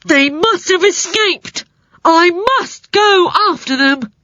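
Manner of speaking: high, slow worried creature voice; alarmed spider voice. A narrator's reading. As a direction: fret like a panicky spider. Spider realizes prisoners escaped.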